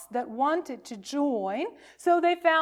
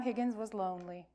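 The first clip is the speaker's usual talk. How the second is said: ordinary delivery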